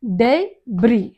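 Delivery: డెబ్రీ split slowly into day, bree; slow teaching model of డెబ్రీ, S silent; syllable-by-syllable. Shown as a demonstration. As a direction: say డెబ్రీ in two separated beats. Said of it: "ఆ ఎస్ silent".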